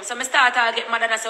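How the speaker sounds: ordinary talk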